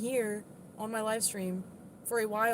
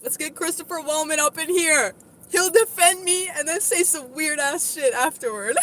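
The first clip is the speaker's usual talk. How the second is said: much higher, much louder excited yelling; excited shouting; 9 semitones higher, 12 dB louder than usual. Real, not acted. Streamer calling a friend onto the live stream, excited shout.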